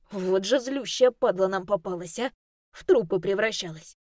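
brash lad, rough and lively; cocky boy voice. Acted. Ru's voice held.

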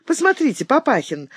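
high-pitched happy call for attention; joyful. A narrator's reading. Emma shows her father a gift, joyfully. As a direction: show Papa something with girlish delight. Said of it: "радостно продолжала она".

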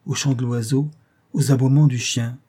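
ordinary delivery

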